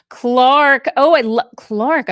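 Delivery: loud, high call of a name; light up calling his name; happy shout-out to a viewer; excited greeting. Real, not acted.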